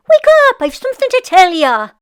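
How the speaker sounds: high, loud wake-up shout; wife shouting her husband awake; urgent shout. Acted. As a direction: jolt him out of his sleep. Wife waking lazy husband.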